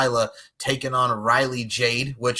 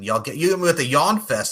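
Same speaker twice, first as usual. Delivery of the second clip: normal talking voice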